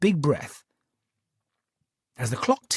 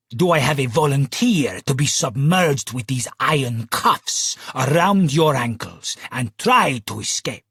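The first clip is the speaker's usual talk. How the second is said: put-on booming Italian accent. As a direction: boom it out like an Italian stage magician. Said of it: "boomed with his Italian accent".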